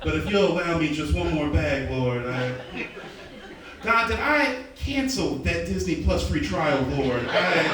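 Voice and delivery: hammed-up preacher praying aloud; mock prayer. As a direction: plead fervently with God over petty things. Comic mock prayer at full voice.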